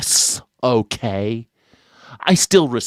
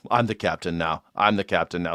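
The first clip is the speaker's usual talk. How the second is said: pirate line quoted; 6 semitones lower, 6 dB quieter, faster than usual. Retold or imitated. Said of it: "who's the pirate".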